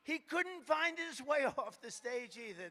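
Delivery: high, laughing mock-critic voice. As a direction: mimic gloating reporters. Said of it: "They say".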